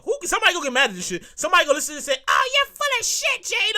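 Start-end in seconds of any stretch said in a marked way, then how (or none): 2.3-3.7 high-pitched mock objection; complaining listener. Offended listener calling out the host, high mock voice. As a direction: whine it high, imitating a critic.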